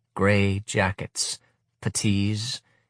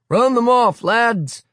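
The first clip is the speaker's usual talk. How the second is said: high, loud rallying yell; command shout; 7 dB louder, slower than usual. Acted. Leader sending boys at veterans, loud rally. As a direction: rouse the lads to charge.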